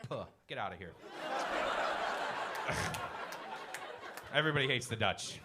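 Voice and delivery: ordinary talk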